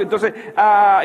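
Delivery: usual speaking voice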